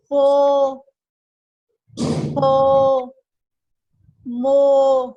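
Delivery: loud, careful model syllables पो and मो; teacher modelling syllables; clear syllable demo. Shown as a demonstration. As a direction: enunciate each syllable for the pupil. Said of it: "अब मैं सारा एक दफा दोबारा से पढ़ूंगी".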